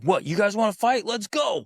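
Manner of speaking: put-on rile the kids up for a play fight; playful challenge. Dad tackling squabbling kids, high playful challenge. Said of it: "and be like".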